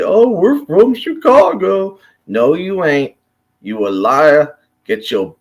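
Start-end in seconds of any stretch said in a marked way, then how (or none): 0.0-2.0 high, mocking whiny poser voice; mocking suburban poser. Mocking suburb kids who claim Chicago, high whiny voice. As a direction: sneer it like a fake city kid. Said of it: "they all try to say".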